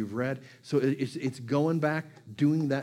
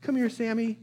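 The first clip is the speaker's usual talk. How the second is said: much higher, sing-song call to a puppy; calling the dog; 10 semitones higher than usual. Retold or imitated. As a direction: coax the dog over, bright and lifted. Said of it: "I'd say".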